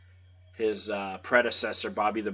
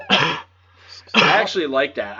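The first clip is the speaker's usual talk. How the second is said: laughing; 5 semitones higher, 10 dB louder than usual. Real, not acted. Laughs while defending his opinion.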